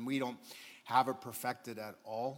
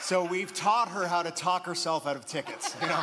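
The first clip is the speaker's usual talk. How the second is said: laughing aside; 6 semitones higher, 8 dB louder than usual. Real, not acted. Still laughing after story.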